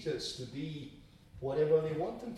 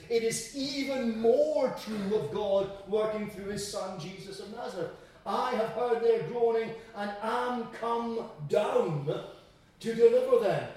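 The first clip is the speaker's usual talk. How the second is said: raised, ringing proclamation with stretched key words; forceful preaching; 7 semitones higher, 6 dB louder than usual. Acted.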